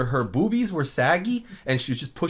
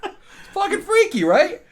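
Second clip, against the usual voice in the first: laughing; 6 dB louder, slower than usual. Real, not acted. Laughing high exclaim.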